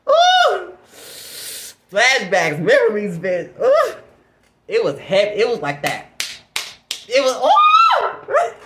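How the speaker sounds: high, loud "Ooh!" squeals, the last one stretched slow; hyped squeals. Real, not acted. Reliving the night for viewers, squeals loud and high. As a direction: squeal over the memory, getting carried away. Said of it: "I'm getting a little too hyped".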